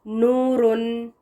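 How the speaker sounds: नूरुन drawn out with long oo; slow model word. Shown as a demonstration. Teacher models नूरुन for students. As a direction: stretch नूरुन slowly. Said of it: "तो इसको ऊ पढ़ेंगे".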